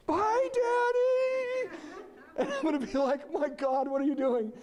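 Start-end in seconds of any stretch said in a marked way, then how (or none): 0.1-1.8 very high, drawn-out squeal; carefree little girl. Retold or imitated. Daughter waving off dad from a motorcycle, gleeful high call. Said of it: "I can see her getting on the back". 3.3-4.5 high-pitched mock alarm; terrified dad. Dad seeing daughter leave without a helmet, mock terrified. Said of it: "And I'll be terrified".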